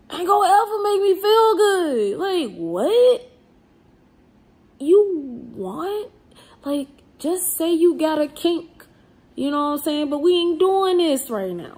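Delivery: high, loud, worked-up outburst; incredulous rant. Real, not acted. Ranting, mock outrage at teasers.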